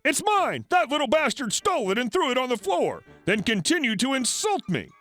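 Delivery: high-pitched accusing protest; indignant outburst. A narrator's reading. Crazy lady falsely accuses valet to boss, indignant outburst. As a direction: burst in crossly, pitch jumping up. Customer cuts in with angry lie.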